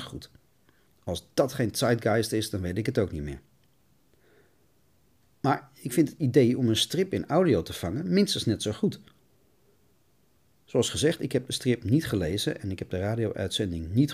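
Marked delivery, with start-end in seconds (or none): none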